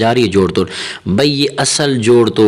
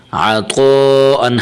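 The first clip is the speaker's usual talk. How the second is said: عطو held long on a level tone; stretched madd; 3 semitones higher, much slower than usual. Shown as a demonstration. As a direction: draw out the طو in عطو for three alifs. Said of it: "الف کے برابر کھینچنا چاہیے". (عطو کا مد لمبا کھینچ کر)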